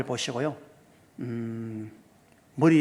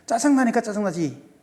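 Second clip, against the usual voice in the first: put-on higher-pitched annoyed child's retort; mock irritation; 6 semitones higher than usual. Young son to dad about his bad test, mock irritated. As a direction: imitate a son snapping back in a huff. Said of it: "그럼 화를 내는 거예요".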